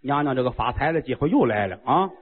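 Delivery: low, quick chuckling voice; a greedy king delighting in easy money; the king gleefully tells his queen another chance to get rich has come. Acted. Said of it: "宣王乐了".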